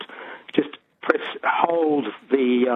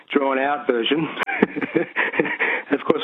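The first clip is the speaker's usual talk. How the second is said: usual speaking voice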